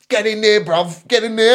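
mock an aggressive southerner giving orders; retold southern English hard-man bark; southern English accent. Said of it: "'cause he's from down south".

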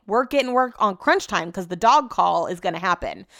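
ordinary talk

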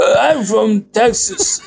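high-pitched attempt at Texas accent. Shown as a demonstration. Guest showing hosts his Texas accent on request, Texas accent. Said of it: "Could you show us the Texas accent?".

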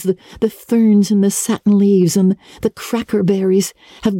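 bright, breathless schoolgirl rambling on; dreamy young Anne. Acted. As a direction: lively chatter of an imaginative girl. Voices Anne, higher and girlish.

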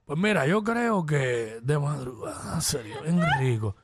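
low seductive imitation of a friend; mock a purring crooner; Sonic's sultry voice. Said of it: "Mira, este fue Sonic".

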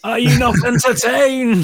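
loud, high arena bellow; quoting the gladiator to the hosts, loud and high; gladiator shout. Acted. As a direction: roar it at the crowd. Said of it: "film line shouted".

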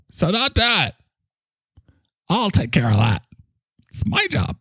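high-pitched cartoonish boss; bossy character, scolding. Bossy character tells International to stop ordering, high scolding voice. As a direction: play a squeaky little boss telling everyone off.

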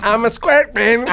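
high, slow superhero catchphrase; mock superhero. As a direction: play Squirrel Man announcing himself. (superhéroe de broma, lento y agudo)

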